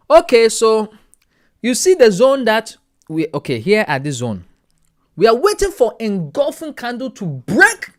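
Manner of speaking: mock African accent, high and loud, slow on the key words. Teaching the course in a joking African accent. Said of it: "with the African accent".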